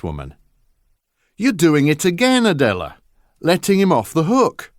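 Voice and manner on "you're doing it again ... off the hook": higher, loud accusation; father snapping at his wife, louder and higher; angry husband. Acted. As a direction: call her out hard.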